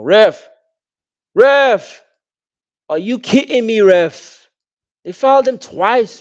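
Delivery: high, loud furious shout; yelling at the ref. Real, not acted. Fan at the TV yelling at the referee, furious. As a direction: scream in outrage at the ref.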